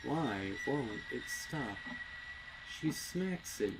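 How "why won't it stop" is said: usual speaking voice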